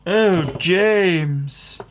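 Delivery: high, loud swooning secretary; hammed-up reply in a put-on high voice; mock Moneypenny. Secretary answering her secret agent James, high mock swoon.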